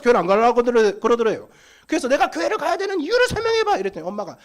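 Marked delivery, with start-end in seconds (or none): 2.1-3.8 put-on high, rising into a loud teen protest; bratty high-pitched teenager getting louder; whiny defiant teen. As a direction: play the stubborn kid yelling back at mom. Same teen demanding an explanation.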